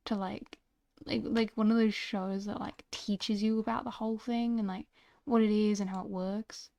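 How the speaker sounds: ordinary delivery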